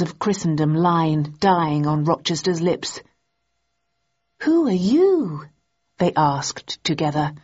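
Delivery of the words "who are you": slow, high, swooping question; astonished, drawn-out challenge; startled demand. A narrator's reading. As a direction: stretch it out, voice leaping up. Startled pair challenge the intruder.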